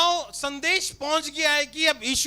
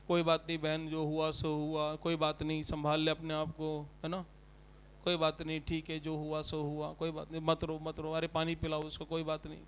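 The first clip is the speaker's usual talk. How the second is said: much lower, hushed mock comforting; mock consoler; 10 semitones lower, 12 dB quieter than usual. Pastor imitating mourners consoling Martha, hushed and low. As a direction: drone a hollow "never mind, sister".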